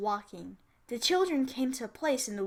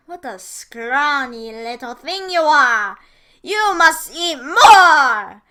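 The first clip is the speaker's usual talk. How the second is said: slow, loud, high witch scolding; 3 semitones higher, 15 dB louder, slower than usual. A narrator's reading. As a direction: drag out each word like a greedy old witch. Witch scolding Hansel's thin finger.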